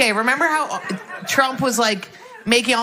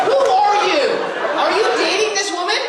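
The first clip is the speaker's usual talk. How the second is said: incredulous yelling; 7 semitones higher than usual. Real, not acted. Grilling the woman's husband.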